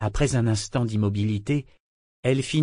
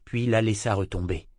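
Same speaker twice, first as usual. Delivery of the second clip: ordinary delivery, nothing marked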